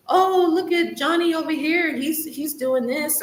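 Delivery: lightly voiced parent comparing the kids; mock narcissistic parent. Narc parent praising one child over another, mock sing-song. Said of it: "the parent will be like".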